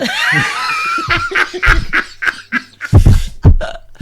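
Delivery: very high, loud laughter; real laughter, high and loud; shrieking laugh. Real, not acted. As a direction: let a big laugh burst out. Laughing at own story.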